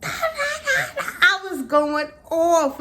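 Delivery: laughing through the words, slowed. Real, not acted. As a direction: breaks into laughter mid-line. Laughing recalling her outburst.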